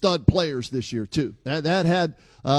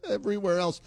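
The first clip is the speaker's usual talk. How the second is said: real laugh running into the words; 4 semitones higher, 4 dB quieter, faster than usual. Real, not acted. Cohost echoing the joke back, laughing. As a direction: repeat it through a chuckle.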